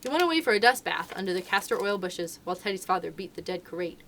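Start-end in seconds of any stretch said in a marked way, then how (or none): none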